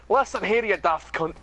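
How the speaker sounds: put-on Scottish accent. Mock Scottish reply banter.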